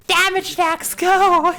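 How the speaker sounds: high, loud battle cry; excited callout. Real, not acted. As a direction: shout the attack name. Calling out a game attack.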